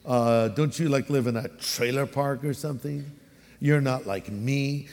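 condescending put-down. Acted. City dweller putting down someone from the sticks, condescending. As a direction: look down on a country bumpkin. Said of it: "A little bit of a put down".

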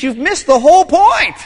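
Jesus correcting. Retold or imitated. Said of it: "Jesus says".

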